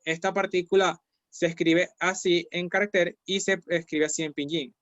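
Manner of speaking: ordinary talk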